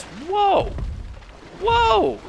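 amazed whoa. Real, not acted. Rare red drop appears.

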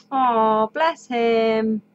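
normal talking voice

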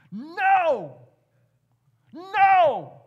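booming, high, shouted NO; emphatic shout. Shown as a demonstration. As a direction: thunder the word No as God. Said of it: "There is an exclamation point God makes".

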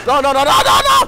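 noes repetidos en grito agudo; pánico real. Real, not acted. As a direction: grita alarmado, fuerte y agudo. Pánico durante la huida. (grito de alarma)

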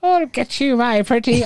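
high, cackling witch taunt; Wicked Witch voice. Pretend. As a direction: sneer it like a storybook witch.

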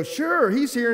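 mother playing along sweetly; mom answering. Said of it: "and she would go".

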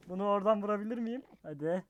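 ordinary delivery

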